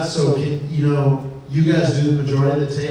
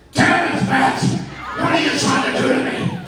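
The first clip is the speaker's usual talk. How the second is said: angry Vince; 8 semitones higher than usual. Retold or imitated. Vince yelling at Matt on the phone, high and angry. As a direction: rant down the phone like an irate boss. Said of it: "and he goes".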